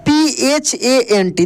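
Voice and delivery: letter by letter, slow and clear; slow, deliberate spelling of each letter. Shown as a demonstration. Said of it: "जैसे elephant".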